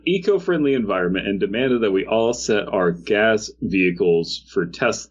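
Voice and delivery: normal talking voice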